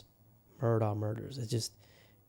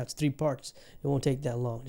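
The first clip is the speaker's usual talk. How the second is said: usual speaking voice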